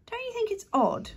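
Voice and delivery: put-on high, wondering girl's voice; a puzzled young girl wonders aloud; Daisy pipes up, high and curious. A narrator's reading.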